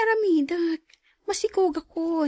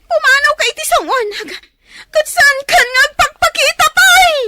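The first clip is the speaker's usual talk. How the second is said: much louder enraged yelling; furious shout; 6 semitones higher, 11 dB louder than usual. Acted. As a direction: throw him out at full voice. Wife drives husband out. (ti asawa a mangpapanaw iti lakayna, agikkis a napungtot)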